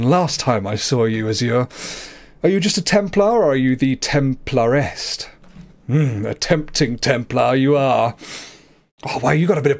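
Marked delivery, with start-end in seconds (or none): none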